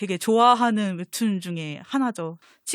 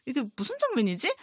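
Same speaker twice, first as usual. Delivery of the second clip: usual speaking voice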